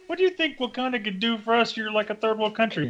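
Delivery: high mock-snooty questioning; prissy reporter mocking Wakanda; condescending reporter. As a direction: sneer down at them in a squeaky voice. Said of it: "He's like".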